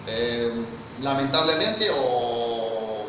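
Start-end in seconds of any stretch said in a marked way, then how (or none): none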